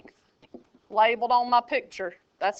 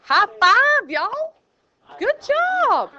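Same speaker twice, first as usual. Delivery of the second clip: excited praise; 9 semitones higher, 6 dB louder, much slower than usual. Real, not acted. Praising class for four fifths.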